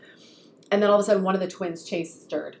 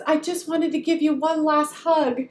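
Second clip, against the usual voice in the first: put-on sweet child voice; 7 semitones higher, 3 dB louder, slower than usual. Quoting small son tenderly.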